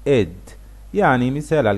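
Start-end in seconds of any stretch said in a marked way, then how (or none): 0.1-0.3 اد said short as a separate syllable; the id sound. Shown as a demonstration. Teacher sounding the ending for learners. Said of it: "ناخد بالنا من الصوت".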